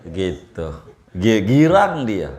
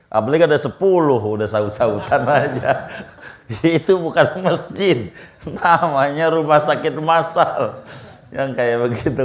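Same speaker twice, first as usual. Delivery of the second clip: ordinary talk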